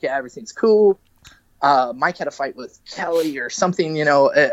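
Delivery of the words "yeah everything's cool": lightly voiced mother, higher and breezy; a hint of mom brushing it off; mom's casual reply. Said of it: "Mom's like".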